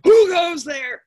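loud, high challenge from below; troll-like challenge. Acted. Bridge voice challenging medium goat, loud and high. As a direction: call it out fiercely. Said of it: "the voice from under the bridge".

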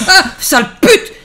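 high, loud frightened scream with a curse; startled scream. Real, not acted. Gamer shrieking at a jump scare, terrified. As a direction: yelp in real terror at the jump scare.

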